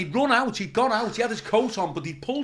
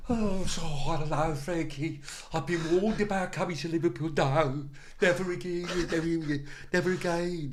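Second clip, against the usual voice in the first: put-on, slightly raised Midlands whine; Brummie accent; 3 semitones higher than usual. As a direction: do a fed-up Brummie, drawing words out. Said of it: "he's very brummy, he was, very kind of Midlands accent".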